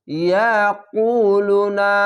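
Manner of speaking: ইয়াকুলুনা with the long vowels stretched; drawn-out recitation. Shown as a demonstration. Teacher showing learners the one-alif stretch, drawn out. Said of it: "পেশের পাশে অসাকিন এক আলিফ টেনে পড়বেন".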